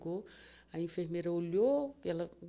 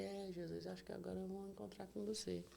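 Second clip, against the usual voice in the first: soft, resigned words to Jesus; faint surrender; 11 dB quieter than usual. Retold or imitated. Dying patient resigned, speaking softly to Jesus. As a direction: let it go in a weak murmur.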